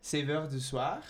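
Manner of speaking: slow attempt at French; French read out carefully; French accent. Shown as a demonstration. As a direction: read it slowly in a shaky learner's French accent. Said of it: "my French accent's gonna be horrible".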